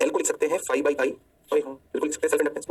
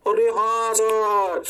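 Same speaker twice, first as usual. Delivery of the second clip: long drawn-out, slightly raised student "yes sir"; chorused student agreement; 4 semitones higher, 3 dB louder, much slower than usual. Acted. Teacher playing students who agree with him, drawn-out agreement. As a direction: stretch it out like a class agreeing.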